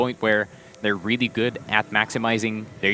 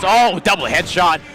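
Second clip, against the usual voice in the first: excited casting; 6 semitones higher, 5 dB louder than usual. Real, not acted. Double headshot call.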